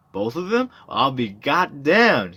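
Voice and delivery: high, then slow and loud amazement; floored by the news; astonished. Acted.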